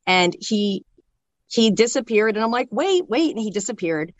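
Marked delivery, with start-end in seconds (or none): 2.7-3.3 retold high-pitched call to stop someone leaving; urgent plea. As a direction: re-enact calling after a vanishing brother. Retold pleading as brother disappears.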